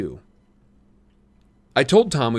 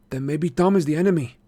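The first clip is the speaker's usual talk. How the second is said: lightly voiced sly boy; 7 semitones higher than usual. A narrator's reading. Marco voiced in story.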